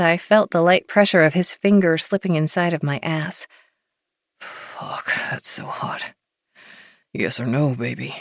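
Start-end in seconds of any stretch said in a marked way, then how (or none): 4.4-6.1 whispered, low and rough; hoarse whisper. A narrator's reading. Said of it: "I barely got my answer out". 7.1-8.1 low murmuring hero. A narrator's reading. Hero voiced low and soft.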